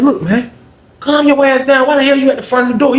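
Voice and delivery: imitating a bossy inmate scolding. Charles scolding Michael in line.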